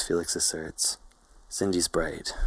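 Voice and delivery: plain reading voice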